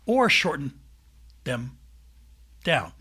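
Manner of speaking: "Or shorten... them... down", long gaps between words; halting, word-by-word delivery; exaggerated pauses. Shown as a demonstration. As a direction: leave a big hole before "them" and "down". Jokingly demonstrates overlong pauses.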